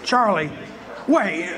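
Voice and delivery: ordinary delivery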